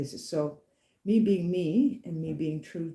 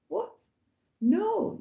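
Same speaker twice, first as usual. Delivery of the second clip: surprised; 4 dB louder than usual. Retold or imitated. Startled by offer of help.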